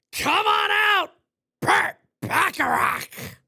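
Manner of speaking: high, loud wrestling-boss bellow; Vince McMahon. Retold or imitated. Streamer summoning a ballplayer, Vince McMahon bellow. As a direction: summon him like a ring announcer. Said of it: "my Vince McMahon impression".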